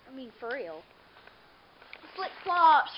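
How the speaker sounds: usual speaking voice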